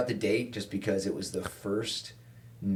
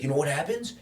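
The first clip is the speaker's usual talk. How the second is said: mock angry rant; 6 semitones higher, 4 dB louder than usual. Bitter cop lecturing a young ride-along, ranting. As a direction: imitate a worked-up rant, pitched up and punchy.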